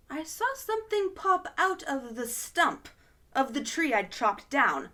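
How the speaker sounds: usual speaking voice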